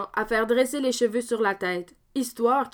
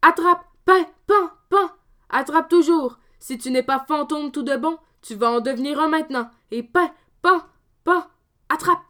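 high loud shouts with each blow; punching Dominique; 5 semitones higher, 5 dB louder than usual. Acted. Dominique thrashing the fake ghost, loud shouted blows.

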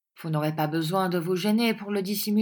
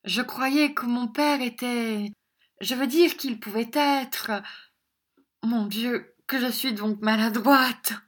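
put-on higher flustered girl, a touch breathless; flustered young lady; 6 semitones higher than usual. A narrator's reading. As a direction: play coy embarrassment, pitched up. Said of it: "dit en tremblant mademoiselle Squeers".

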